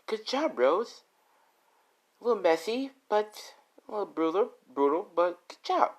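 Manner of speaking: high, pleased mother voice; mother praising Rose for the kill, high and pleased; proud mother. Acted. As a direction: praise her like a proud, twisted mom. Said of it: "As her mother's like".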